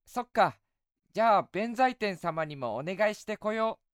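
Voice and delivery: put-on high, eager young boy. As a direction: play a cheerful little boy. Boy voice in story.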